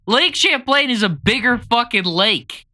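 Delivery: high-pitched, indignant protest; indignant retort. Real, not acted. Heated monster debate with co-host.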